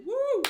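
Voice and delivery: loud, high mock "woo"; excited whoop. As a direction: whoop like you just closed the deal.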